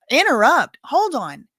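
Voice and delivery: imitated shout. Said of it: "Why are you screaming?".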